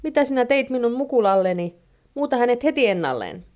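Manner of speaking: high, indignant storytelling voice; alarmed shout. A narrator's reading. Mother scolds the genie over her child, high indignant demand. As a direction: demand it in a mild, high-pitched outcry. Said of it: "huutaa Heinoska".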